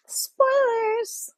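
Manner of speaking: high, drawn-out teasing call; playful warning. Pretend. Reader warns against spoiling the book, playful high call.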